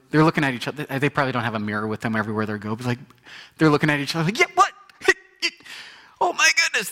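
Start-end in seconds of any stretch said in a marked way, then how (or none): 4.4-6.9 much higher, louder, astonished squeal; overjoyed disbelief; amazed lepers. Retold or imitated. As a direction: lepers seeing each other healed, astonished and thrilled. Lepers staring at each other.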